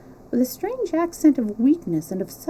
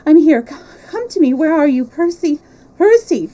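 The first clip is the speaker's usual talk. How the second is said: desperate calling; 7 semitones higher, 10 dB louder than usual. A narrator's reading.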